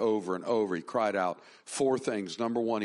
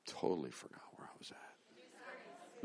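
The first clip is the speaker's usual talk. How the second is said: a quick unvoiced self-aside; whispered aside; 8 semitones lower, 16 dB quieter than usual. Real, not acted. Pastor whispering to himself after losing his thread. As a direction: whisper it to yourself, lost your place.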